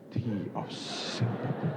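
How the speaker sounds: slow, soft, breathy relish; savoring whisper. Acted. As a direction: savor each word like sweet tea. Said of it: "Sampai ke pori-pori saya itu sampai berkata". (bisikan pelan penuh rasa)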